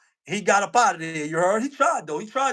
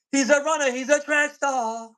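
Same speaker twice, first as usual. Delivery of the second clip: mock proud announcement; 5 semitones higher than usual. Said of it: "repeated mocking cheer".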